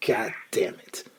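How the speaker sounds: whispered under the breath; whispered curse. Real, not acted. Muttering curse at rally monkey.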